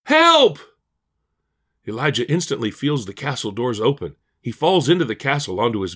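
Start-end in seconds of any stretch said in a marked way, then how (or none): 0.0-0.6 very high, loud scream for help. A narrator's reading. Elijah backed against the castle door, screaming. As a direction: scream out in high-pitched panic.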